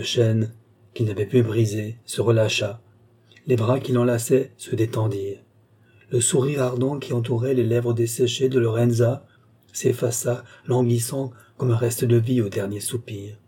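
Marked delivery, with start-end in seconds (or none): none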